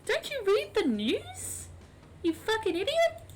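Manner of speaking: appalled princess of the sea; hammed-up offended mermaid; indignant mermaid girl. [name] scolding Scott.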